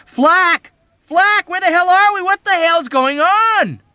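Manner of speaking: very high, loud panicked shriek. Acted. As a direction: scream in panic over the intercom. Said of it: "McWatt was shrieking over the intercom".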